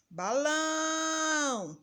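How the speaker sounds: "Balão" called high, long held "lão"; calling out the word. Shown as a demonstration. Teacher giving another example, "Balão" called out with "lão" drawn out. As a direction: call out "Balão", stretching the last syllable. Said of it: "pronunciar como se estivesse chamando a palavra".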